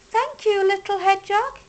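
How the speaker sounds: high, bright grateful squeak; grateful mice. A narrator's reading. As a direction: thank the hedgehog like tiny mice. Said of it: "they squeaked".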